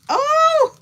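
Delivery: very high, loud startled oh; sudden high exclamation; surprised oh. Real, not acted. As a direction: gasp it out. Sudden realization.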